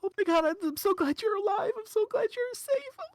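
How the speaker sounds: put-on very high, loud little-kid voice, overwhelmed with relief; teary small child. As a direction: gush like a tearful child who is hugely relieved. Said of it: "through a teary-eyed and teary face".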